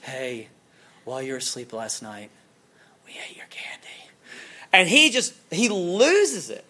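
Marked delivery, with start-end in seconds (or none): 0.0-3.9 quiet, breathy parent whisper. Retold or imitated. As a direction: whisper the bad news to a groggy child. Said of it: "And they say".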